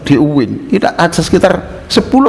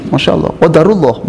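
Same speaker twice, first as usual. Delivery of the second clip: higher, gushing string of pious exclamations; mock-devout Masya Allah, much higher; mock pious talker; 8 semitones higher than usual. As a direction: mimic a show-off pious suitor. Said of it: "ngomongnya Masya Allah".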